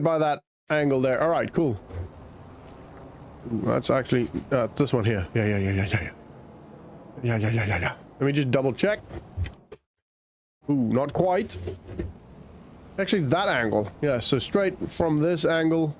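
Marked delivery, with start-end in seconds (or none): none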